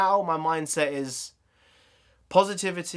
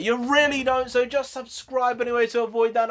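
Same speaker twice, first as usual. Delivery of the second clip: playful warning; 10 semitones higher, 5 dB louder than usual. Acted. Playful warning about Vader.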